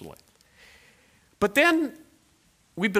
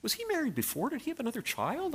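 puzzled inner voice; 8 semitones higher, 8 dB quieter than usual. Retold or imitated. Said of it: "And I was going".

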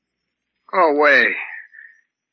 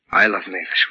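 ordinary talk

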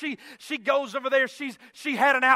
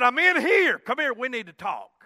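normal talking voice

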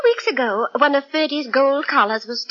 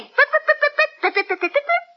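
much higher, yapping pip pips; imitating her dog. Lady voicing her pet dog for Holmes, high yapping mimicry. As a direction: playfully mimic the little dog's voice. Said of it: "He said".

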